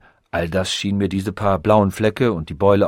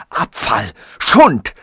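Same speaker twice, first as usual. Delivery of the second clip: insults bursting high and loud; furious contempt; 8 semitones higher, 6 dB louder than usual. A narrator's reading. Robot condemns evolution as botched work, furious. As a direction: climb to a loud, scolding peak.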